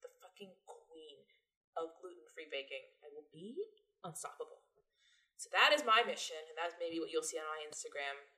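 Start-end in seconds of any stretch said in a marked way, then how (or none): 0.0-4.6 quiet determined vow. Retold or imitated. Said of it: "I was like".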